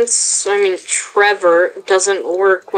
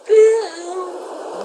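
squeaky voice; 10 semitones higher, 3 dB quieter than usual. Pretend. Squeaky character voice.

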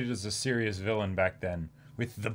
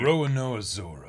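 lightly voiced swordsman, slowed; drawn-out name in a lightly put-on tough voice; a hint of a stern swordsman; Zoro voice; 3 semitones higher than usual. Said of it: "our voices for them".

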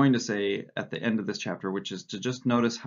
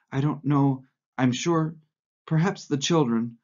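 higher, unsure housewife voice; spineless dithering; 5 semitones higher, 5 dB louder than usual. A narrator's reading. Flustered Missus Parsons answering Winston about the spanner, helplessly unsure. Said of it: "immediately becoming invertebrate".

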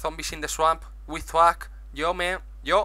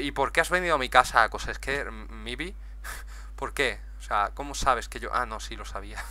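ordinary talk

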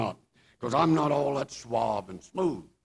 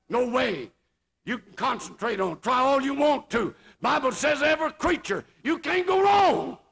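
rising pulpit exhortation, pitched high; fervent, high-pitched preaching; full-force preaching; 9 semitones higher, 4 dB louder than usual. Real, not acted. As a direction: build up to a high preacher's cry.

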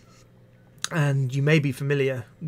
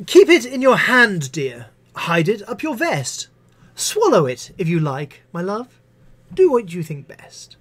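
higher, louder exasperated teacher; fed-up teacher; 5 semitones higher, 7 dB louder than usual. Acted. Missus Butler losing patience with the pupil, exasperated. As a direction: wave the child away.

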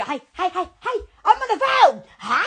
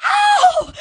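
high, loud gushing "Oh, it's a babe"; squealing at a cute bunny; delighted squeal; 13 semitones higher, 4 dB louder than usual. Real, not acted. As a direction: gush over something cute.

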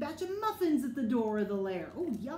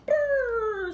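loud high shiver sound; shivering brrr; 10 semitones higher, 12 dB louder than usual. Acted. Badger comes in from the cold, shivering loudly. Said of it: "says Badger".

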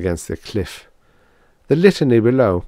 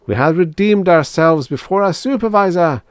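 high, loud triumphant declaration; exultant robot worshipper; exclaiming; 10 semitones higher, 5 dB louder than usual. A narrator's reading. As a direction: proclaim it with religious exultation. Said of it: "exclaimed seventy-six".

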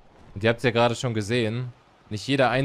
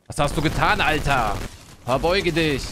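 dark lord; 4 semitones higher, 4 dB louder than usual. Pretend. Playing Zeus, commanding a player.

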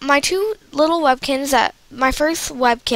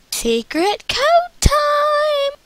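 high, slow, playful sing-song call; singsong announcement; 4 dB louder, much slower than usual. Acted. Playful announcement before pausing video.

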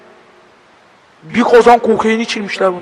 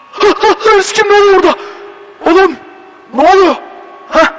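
nervous panic; 10 semitones higher than usual. Real, not acted. Another scare, high panicked shouts.

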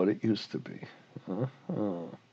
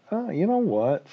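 high-pitched acted comeback; lightly acted aggrieved owner; owner's retort; 11 semitones higher than usual. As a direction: open the owner's rebuttal with a raised pitch. Owner acted out answering regulator.

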